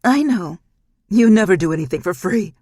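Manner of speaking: loud, harsh snarl; loud furious demon snarl. Acted. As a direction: spit it at her in her demon voice. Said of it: "she said with her demon voice".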